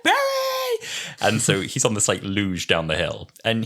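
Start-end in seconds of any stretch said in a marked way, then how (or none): 0.0-0.9 very high wailed name; mock panicked call. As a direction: call out as you roll away. Acting Doctor rolling downhill.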